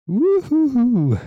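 high wavering ghostly woo; spooky ghost hoot. Acted. Ghost hoot ending the story.

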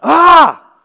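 sudden high, loud cry of pain; pain yelp. Retold or imitated. Water hits the cut hand, loud high yelp. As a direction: yelp as it stings.

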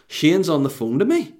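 normal talking voice

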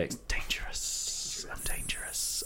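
hushed, dangerous whisper; a soft, menacing murmur; quiet menace. Shown as a demonstration. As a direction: whisper it like a threat. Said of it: "and quiet".

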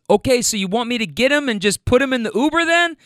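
acted caller hurriedly confirming plans; man on phone. Friend still on the phone.

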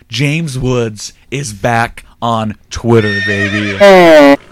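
put-on drawn out, building to a loud final BABY; slow hype build, big loud finish; triumphant announcement. Announcer-style celebration of a return.

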